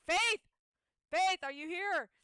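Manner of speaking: high, loud urgent call. Retold or imitated. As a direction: call out across the house. Calling out for someone in panic.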